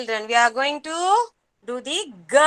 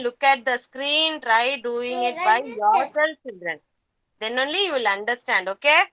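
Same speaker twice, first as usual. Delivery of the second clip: normal talking voice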